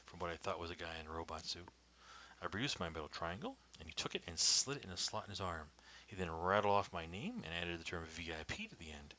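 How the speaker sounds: ordinary delivery, nothing marked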